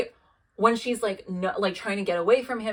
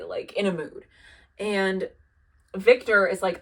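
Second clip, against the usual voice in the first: usual speaking voice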